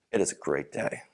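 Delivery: low, quiet, unenthusiastic mumble; glum. Shown as a demonstration. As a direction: say it like you don't mean it at all. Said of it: "I said two very different things".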